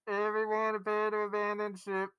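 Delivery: shouting abandon ship. Acted. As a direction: repeat the intercom warning, calmer. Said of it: "hear the muffled, statically intercom".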